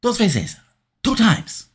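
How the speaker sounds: high, strained mock Eddie shout; angry Eddie Guerrero. An angry Eddie backstage kicking benches, strained and high. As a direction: rage it out in a put-on voice. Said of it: "And he says".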